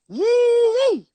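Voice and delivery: short high-pitched "yee" vocal demo; shrill high yelp, short and sharp. Shown as a demonstration. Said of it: "if I asked you to go".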